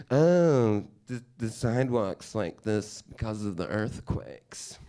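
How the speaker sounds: slow, airy mock dim girl. Date's friend explaining sidewalk cracks, slow mock dim voice. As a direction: drawl it out like she's clueless. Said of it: "this fucking stupid bitch is like".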